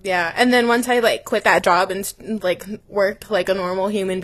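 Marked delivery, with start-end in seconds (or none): none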